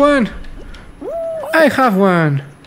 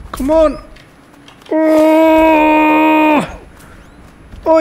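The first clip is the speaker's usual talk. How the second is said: ordinary delivery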